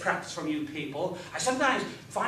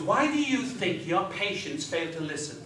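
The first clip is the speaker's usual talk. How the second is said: usual speaking voice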